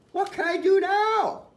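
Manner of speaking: put-on high-pitched fretting boy; worried boy. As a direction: wail it in mild panic. Boy in a panic over the fish.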